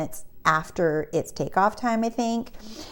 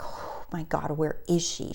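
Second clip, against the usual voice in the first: soft retold "oh my God" of dread; hushed worry; 2 semitones lower, 9 dB quieter than usual. Waiting on the held plane for her missing friend, hushed worry.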